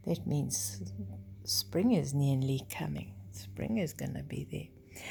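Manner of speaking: soft unvoiced whisper; whispered aside. Real, not acted. Parent tells child spring is coming, in a whisper. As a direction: lean in and whisper the secret.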